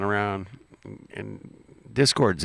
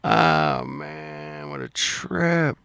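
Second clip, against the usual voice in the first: delighted laugh; 6 semitones higher, much slower than usual. Real, not acted. Greeting an old friend, laughing.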